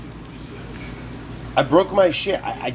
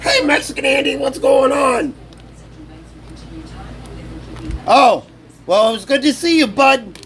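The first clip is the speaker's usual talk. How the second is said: mock greeting; 9 semitones higher than usual.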